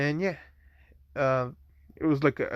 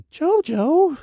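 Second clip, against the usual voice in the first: shocked Jojo; 6 dB louder than usual. Retold or imitated. Momo blushing, caught off guard.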